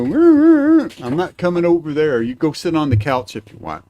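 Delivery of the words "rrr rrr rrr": high, loud mock growl; mimicking the cat's noise, high and loud; imitating cat growl. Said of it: "You gonna go".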